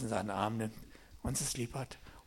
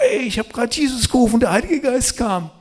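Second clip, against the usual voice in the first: high, loud and breathless with excitement; excited report; 11 semitones higher, 13 dB louder than usual. Retold or imitated. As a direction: rush to tell the co-leader the news. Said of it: "hab gesagt". (aufgeregt, hoch und laut)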